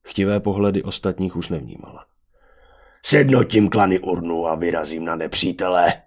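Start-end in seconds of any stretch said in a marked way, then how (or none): 3.0-5.9 booming brutish warrior voice; brute warlord proclaiming. A narrator's reading. Venátor answers Oren's question, proclaiming grandly.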